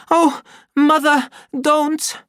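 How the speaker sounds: put-on high, loud begging child; frightened plea. The boy begs his mother to stop hitting him. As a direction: beg her to stop. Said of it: "he cried out".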